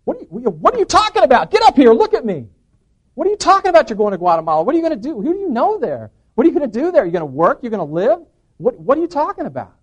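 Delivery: loud, high, rapid-fire disbelief; incredulous outburst. Retold or imitated. Challenging brother about going to Guatemala, incredulous. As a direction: fire off questions in loud alarm.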